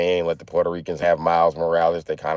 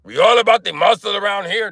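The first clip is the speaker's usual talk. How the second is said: Arnold; 10 semitones higher than usual. Acted. Trainer bragging he will get a girly man big, hammed-up Arnold impression. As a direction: push it out like a pumped-up muscle-man trainer. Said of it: "On that Arnold shit".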